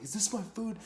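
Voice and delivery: high, pushy question; demanding question. Retold or imitated. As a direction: ask it like a drunk demanding his plate. Drunk demanding plates in kitchen.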